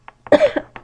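sharp cough mid-sentence; sudden cough. Real, not acted. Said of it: "I'm sorry, hang on a second".

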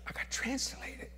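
hushed whisper. Real, not acted. Telling George the secret, whispered. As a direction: let it slip out as a secret. Hushed aside about being translated.

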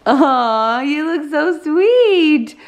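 put-on high, sing-song baby talk. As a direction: coo to the baby. Coos at baby.

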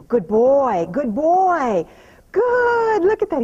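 loud, high praise for the horse. Real, not acted. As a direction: lift your voice to reward him.

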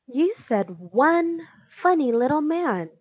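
understated narrated yell; yelling accusation. A narrator's reading. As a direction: accuse him, pitch swinging up. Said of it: "Don't yell at him".

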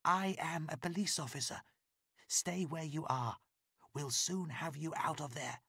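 calm her down firmly; lightly voiced policewoman, reassuring. A narrator's reading. Layla reassures Christine.

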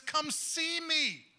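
God challenging doubters, slow drawn-out call; God's invitation. Retold or imitated. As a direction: stretch each word out like a summons.

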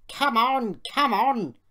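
loud, high, effortful urging; straining puff. A narrator's reading. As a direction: heave each phrase out loudly. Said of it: "he puffed".

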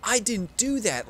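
high, loud mock-innocent protest; excited denial. Real, not acted. Player gleefully denying a teammate kill. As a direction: protest your innocence, thrilled.

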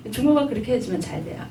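ordinary talk, nothing marked

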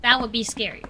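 ordinary delivery

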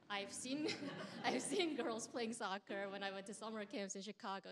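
retelling it with a laugh breaking in; laughing through it. Retold or imitated. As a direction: laugh while you quote your younger self. Retold rant about soccer.

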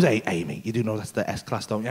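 low, hushed salesman's aside; discreet aside. Retold or imitated. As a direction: lean in and drop your voice. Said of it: "so he just leans in and goes".

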